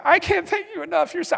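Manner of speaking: high, loud, overflowing gratitude; loud, giddy, over-the-top thankfulness; gushing nurse thanks. Retold or imitated. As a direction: gush your thanks like you're high on painkillers. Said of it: "I was like".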